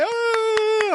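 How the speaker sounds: very high, drawn-out mock "ooh"; mock audience cheer. Audience seeing a favourite character, mock cheer. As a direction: imitate a crowd's excited squeal.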